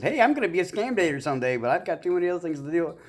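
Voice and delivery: high, playful mock voice, pitched well up; squeaky imitation of his own excited claim; mock self-quote. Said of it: "I'm like".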